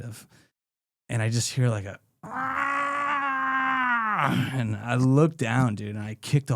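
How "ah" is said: sudden high pained "Ah!"; startled yelp. Retold or imitated. Retelling the homeless guy's cry when kicked, a high yelp. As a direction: yelp like someone kicked awake.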